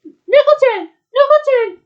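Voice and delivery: very high, loud repeated call; full-voiced, high-pitched cry. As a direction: yell the name twice in worry. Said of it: "she cried".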